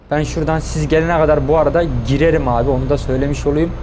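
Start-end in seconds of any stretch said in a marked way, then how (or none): none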